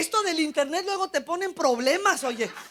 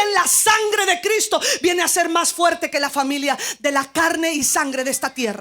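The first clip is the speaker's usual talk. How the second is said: loud, high, impassioned proclamation; full-force preaching; 6 semitones higher, 10 dB louder than usual. Real, not acted. Preacher declaring God's message to the congregation, fervent shouting. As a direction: drive each phrase home to the congregation at shouting strength.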